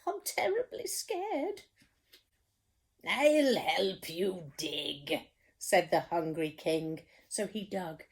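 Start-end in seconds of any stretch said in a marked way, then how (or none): 0.1-1.6 high, whimpering "I'm scared". Acted. Storyteller voicing the cook, high and whimpering. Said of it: "the cook declared". 3.0-5.3 slow, kindly offer of help; calm the frightened cook; reassuring king. Acted. King reassuring the scared cook, slow and kind.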